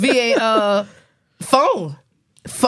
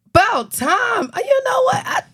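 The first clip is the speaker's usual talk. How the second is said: excited greeting. Real, not acted. Greeting returning co-host excitedly.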